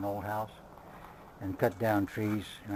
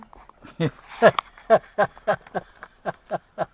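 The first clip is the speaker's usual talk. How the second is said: loud, high burst of laughter; 8 semitones higher, 11 dB louder than usual. Real, not acted. Vlogger laughing loudly after mocking dog talk. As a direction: laugh at the impression.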